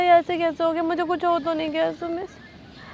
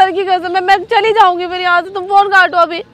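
louder, insistent pretend begging; mock desperate plea; 2 semitones higher, 11 dB louder than usual. Girlfriend loudly begs boyfriend to hang up, faking panic. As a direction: push hard, as if truly desperate. Pleading to stop the phone call.

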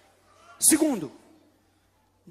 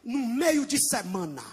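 usual speaking voice

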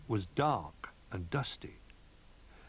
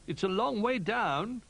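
high, slow little bear voice; 13 semitones higher, 4 dB louder than usual. A narrator's reading. Little Bear up the tree, high and wary. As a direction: draw it out in a small, high voice.

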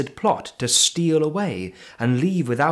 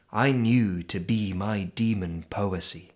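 ordinary delivery, nothing marked

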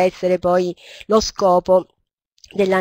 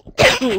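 high sharp real cough; chesty cough; 4 dB louder than usual. Real, not acted. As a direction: cough from a sore throat mid-phrase. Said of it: "Scusate questa tosse".

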